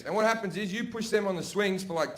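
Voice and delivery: usual speaking voice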